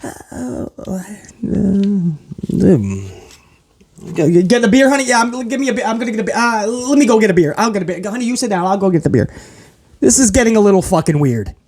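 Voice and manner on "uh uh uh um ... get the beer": hushed, hesitant mock excuses; awkward crowd. Crowd dodging the moment, mock awkward mumble. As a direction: stall and slip away awkwardly. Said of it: "the crowd's like".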